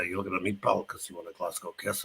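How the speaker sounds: a lightly done Glaswegian growl, low and soft; menacing Glasgow hardman accent, understated. Shown as a demonstration. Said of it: "Otherwise, it's like Glasgow".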